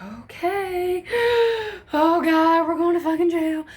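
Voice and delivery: mock terror at going to jail; hammed-up gasp and high frightened wail; panicked gasp. Friends panicking in the truck.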